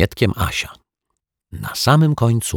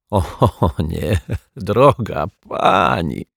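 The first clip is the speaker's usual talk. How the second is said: mild choked-out reply through laughter; 2 semitones higher, much slower than usual. A narrator's reading. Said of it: "wykrztusił w końcu".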